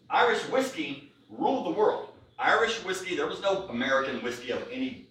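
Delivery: normal talking voice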